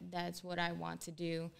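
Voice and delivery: ordinary talk, nothing marked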